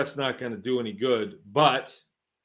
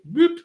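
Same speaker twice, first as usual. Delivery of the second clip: quick high, loud whoop; imitated whoop; 7 dB louder than usual. Flunky brushing off a caller's phone call, quick high whoop. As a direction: toss out a sudden rising "whoop".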